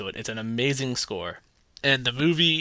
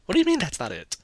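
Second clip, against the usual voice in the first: normal talking voice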